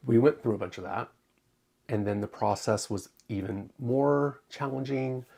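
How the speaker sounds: normal talking voice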